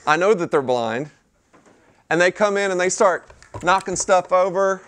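ordinary talk, nothing marked

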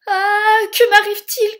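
high, very loud panic; alarmed cry. A narrator's reading. Gus hit by the magic wand, crying out. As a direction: cry out in startled fright. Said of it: "crie Gus".

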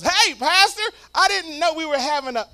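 high, loud chirpy church lady greeting; mock church lady. Lady greeting the pastor at the door, high and loud. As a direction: put on a bright surprised lady voice. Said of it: "And she goes".

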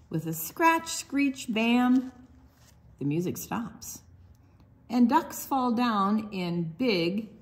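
sing-song picture book read; reading to children. Acted. Librarian reads duck book to children, sing-song. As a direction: bounce the rhymes for little listeners.

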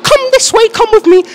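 high, loud mock shop lady; imitating shop worker. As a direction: squeak it like a bossy staffer. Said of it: "And she was like".

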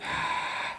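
long whispered "haaa" of air; voiceless open-throated huff; breathy exhale. Shown as a demonstration. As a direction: fog up a phone screen with breath. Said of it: "clean their screen and say".

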